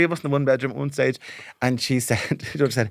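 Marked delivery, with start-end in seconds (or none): none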